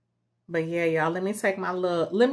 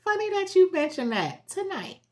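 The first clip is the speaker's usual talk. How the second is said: high coy voice; 13 semitones higher than usual. Retold or imitated. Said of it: "I was like".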